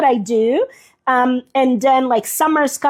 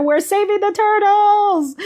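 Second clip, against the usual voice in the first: high, chirpy cheer; cheerful high slogan voice; peppy slogan; 7 semitones higher than usual. Acted. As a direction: announce it like a happy campaign. Repeats the sticker slogan playfully.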